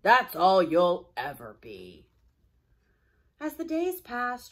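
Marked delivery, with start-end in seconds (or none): 0.0-2.1 put-on slow, loud old-man sneer remembered; the scruffy old-timer's words echoing back; echoed gruff old-timer. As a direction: let it ring like a taunt in his head. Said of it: "The words of Old Mac still echoed".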